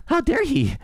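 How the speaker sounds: very high whiny mock voice; mock outrage. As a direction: parody a man offended at being insulted. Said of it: "mocking the caller's outrage".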